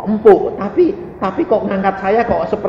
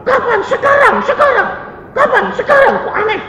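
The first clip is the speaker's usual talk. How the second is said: high-pitched mimicry of arguing; bantah-bantahan. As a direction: squeak out a bickering imitation. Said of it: "bantah-bantahan sama orang". (menirukan orang berbantahan, suara melengking)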